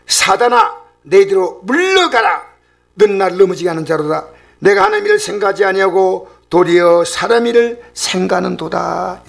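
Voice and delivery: stern acted-out reprimand; direct rebuke. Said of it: "바로 직격탄을 날리는 겁니다".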